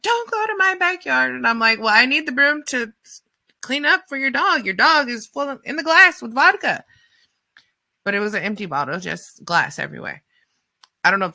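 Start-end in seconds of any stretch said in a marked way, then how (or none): 0.0-1.3 shrill-high panicked mock of the owner; freaking out lady. Panicked owner warning the helper off, very high. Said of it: "the lady's freaking out". 1.8-6.8 exasperated pleading. Retold or imitated. Arguing back with the lady.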